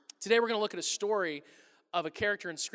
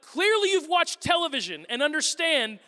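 loud, high, exasperated rant; raised mock-indignant voice; indignant outburst; 7 semitones higher, 7 dB louder, slower than usual. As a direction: insist loudly that they are the weird ones. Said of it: "And I'm like".

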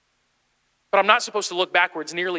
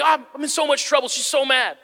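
retold fretting; 8 semitones higher than usual. Brother frets about angry mom.

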